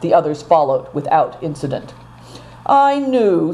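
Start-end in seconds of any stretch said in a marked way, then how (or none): 2.6-3.5 sighing. A narrator's reading. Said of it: "sighed Affliction".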